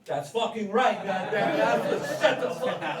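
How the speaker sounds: loud, high crowd-pumping yell; hype shout. Real, not acted. Comic whipping up the crowd, loud shout. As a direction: fire up the room. Hyping crowd about beer.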